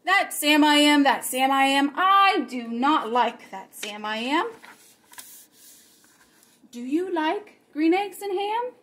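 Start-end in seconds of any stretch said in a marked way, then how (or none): none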